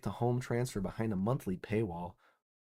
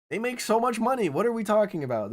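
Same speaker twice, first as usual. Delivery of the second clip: higher and louder, exasperated; indignant outburst; 8 semitones higher, 6 dB louder than usual. Real, not acted. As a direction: blurt it out in disbelief at the greed. Ranting at Nintendo's greed.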